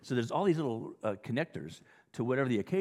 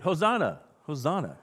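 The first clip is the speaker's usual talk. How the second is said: high, loud "Hosanna" called out to a crowd; acclaiming shout; 4 semitones higher, 6 dB louder than usual. Shown as a demonstration. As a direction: cheer "Hosanna" like waving palm branches. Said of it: "you can say it".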